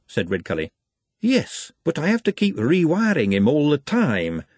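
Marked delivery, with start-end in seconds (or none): 1.2-4.4 higher-pitched, weary complaint; sighing. A narrator's reading. Doctor complains of rewiring his assistant, sighing. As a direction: say it on a sigh. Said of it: "sighed Hicks".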